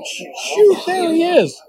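very high excited urging; urgent hissed command. Retold or imitated. Father urging daughter as the buck reappears, very high and urgent. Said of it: "I'm going".

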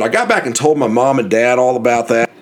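ordinary delivery

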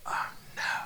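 hushed, whispered mock horror; whispered dread. Realizing the door is unlocked, whispered acted dread.

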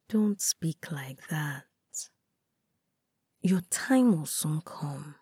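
slow, hushed reassurance. A narrator's reading. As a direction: gently hush her worry. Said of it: "I know she means no contempt".

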